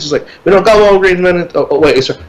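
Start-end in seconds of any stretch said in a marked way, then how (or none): 0.4-1.5 put-on Puerto Rican accent. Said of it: "Because it's a Puerto Rican accent".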